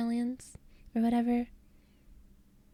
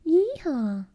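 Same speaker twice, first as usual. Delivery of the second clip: high, louder cowboy yeehaw; 7 semitones higher, 5 dB louder than usual. Acted. Streamer on accents playing the American, high loud cowboy whoop. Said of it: "idea of an American accent is very".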